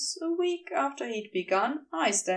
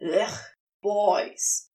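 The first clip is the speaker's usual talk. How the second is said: disgusted groan; 3 semitones lower than usual. A narrator's reading. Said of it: "Bees groaned in disgust".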